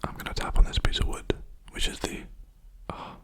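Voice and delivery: unvoiced ASMR whisper. Real, not acted. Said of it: "I keep whispering, sorry, force of habit".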